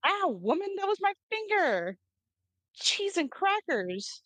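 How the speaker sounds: high, yelping complaint; pained yelp. Real, not acted. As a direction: yelp out, finger hurt. Finger hurt, yelps in pain.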